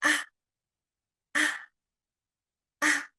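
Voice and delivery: "Ah" very short, with no glide; tiny clipped "Ah"; neutral tone. Shown as a demonstration. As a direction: say "Ah" light and brief. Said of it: "neutral tones pronunciation is light and short".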